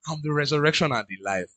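soft, low, composed quote; imitating a calm, assured speaker. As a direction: say it quietly, utterly unruffled. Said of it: "Jesus was literally calm".

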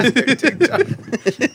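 slow, high, drawn out through laughter; laughing repeat. Acted. Friend echoing the joke, slow and laughing. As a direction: stretch it out, amused.

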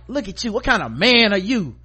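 high, loud mocking contempt; scornful mom. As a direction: shame the husband.

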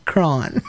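cron as one syllable; imitated pronunciation. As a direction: say cron to rhyme with on. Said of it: "It sounds like this".

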